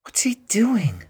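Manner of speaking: a tourist's breathy whisper, high and unvoiced; hushed whisper. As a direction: whisper it so nobody near hears. Tourist asks quietly in crowd.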